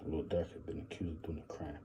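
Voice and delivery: unvoiced whisper. Real, not acted. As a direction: hush it like a secret. Whispered serious line.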